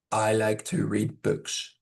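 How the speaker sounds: slow, low, toneless example sentence; flat monotone. Shown as a demonstration. Teacher showing students a very flat reading, monotone. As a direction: read it in a dull monotone. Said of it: "Very flat".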